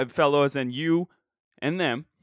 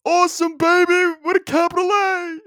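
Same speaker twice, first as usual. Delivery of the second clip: much higher and much louder, hollering like a courtside announcer; Dick Vitale impression; 14 semitones higher, 7 dB louder than usual. Podcaster mocks hoax believers in a sportscaster's hollering voice. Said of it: "This is my impression of Dick Vitale".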